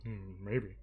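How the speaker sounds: put-on low, soft old man rumble; a sly old man's murmur; old Madara. Old Madara voiced in recap.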